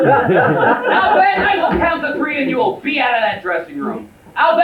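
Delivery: exasperated ultimatum. Acted. Husband orders Alvin from dressing room, high angry shout. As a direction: shout the threat across the rooms.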